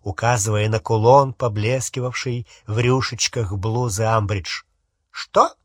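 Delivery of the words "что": high, sudden sharp "what?"; sharp retort. A narrator's reading. Umbridge answering a compliment, sharply. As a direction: snap it out high-pitched. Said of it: "резко отозвалась Амбридж".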